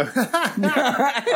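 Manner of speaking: high-pitched giggle fit; real rising laugh. Real, not acted.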